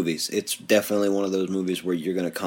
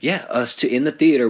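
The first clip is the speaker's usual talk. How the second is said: ordinary delivery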